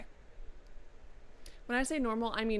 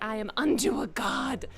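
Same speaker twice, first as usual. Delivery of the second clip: grand boast; 7 dB louder than usual. Pretend. Dictated boast, hyped up.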